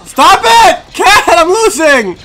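very high, loud yell; frantic yelling. Real, not acted. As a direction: shriek at the cat in exasperation. Yells at cat mid-game.

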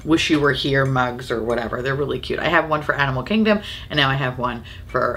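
ordinary talk, nothing marked

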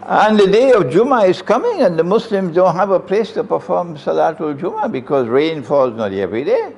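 usual speaking voice

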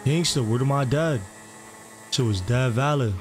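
smooth hustler character. Acted. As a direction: play a smooth-talking record label boss. Plays RP character Tay Cardi.

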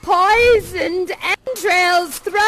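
slow chanted witch spell; first witch. Acted. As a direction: intone the spell, stretching each word. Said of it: "the first witch says".

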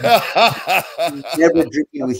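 laughing mock outrage, slow; laughing retort. Answering Taker's jibe, laughing mock insult. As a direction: curse him out through laughter.

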